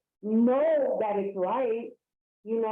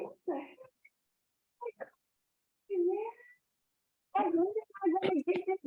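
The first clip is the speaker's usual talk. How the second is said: higher, much softer mock whimper of frustration; frustrated whine; 10 semitones higher, 6 dB quieter, faster than usual. Dieter complaining to the secretary, higher and softer, whiny. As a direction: whine it out, almost crying. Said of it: "And you're like".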